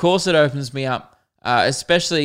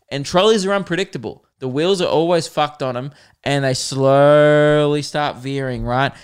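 ordinary talk

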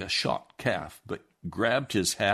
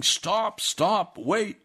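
higher, pitched-up calls to stop the wagon; retold yelling; 9 semitones higher, 3 dB louder, much slower than usual. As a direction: call after the wagon, mildly yelled. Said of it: "shouting and yelling".

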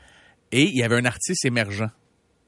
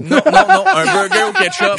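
laughing; 10 dB louder, slower than usual. Acted. Moquerie de la combinaison.

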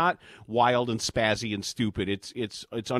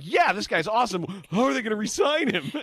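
mock fan excitement; 9 semitones higher, 4 dB louder than usual. Acts out excited fans' reaction.

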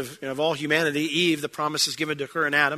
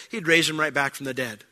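ordinary delivery

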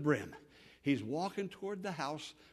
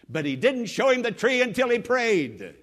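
loud, emphatic climax; forceful preaching; 5 semitones higher, 9 dB louder than usual. Real, not acted. Preacher closing on Moses's prayer, forceful preaching. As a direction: ring out the turning point.

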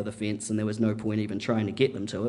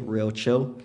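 usual speaking voice

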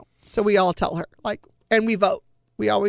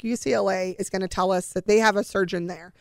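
ordinary delivery

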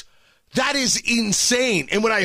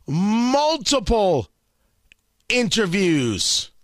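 slow emphasis; much slower than usual. Real, not acted.

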